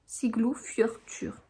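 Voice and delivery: "Siglufjörður" slow and hushed, part by part; "Siglufjörður" as Siglu, fyeur, tur, nearly whispered; careful pronunciation. Shown as a demonstration. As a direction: softly sound out "Siglufjörður", ending in "-tur". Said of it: "ça se dit fjord".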